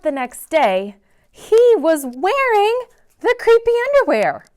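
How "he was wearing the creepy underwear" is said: high, loud shocked storyteller; shocked reveal. A narrator's reading. Narrator reveals he wakes in the underwear, shocked. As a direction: gasp it out in disbelief.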